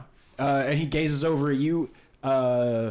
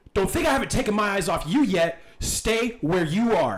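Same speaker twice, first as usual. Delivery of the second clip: raised, higher commanding voice; stern warning; 7 semitones higher, 5 dB louder than usual. Acted. Clyde warning a suspect to stay put, stern and loud. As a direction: order them to freeze, loud and slowing.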